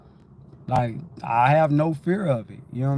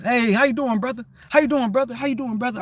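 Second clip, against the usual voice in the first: much higher, cheery hello to each person; friendly greeting; 9 semitones higher, faster than usual. Retold or imitated. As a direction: greet every coworker like a brother. Acts out his usual greeting.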